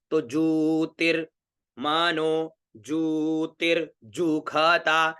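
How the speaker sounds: ज्योतिर्जुखता with a half ज then a full जु, said slowly; slow careful pronunciation. Shown as a demonstration. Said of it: "आधा ज पूरा ज".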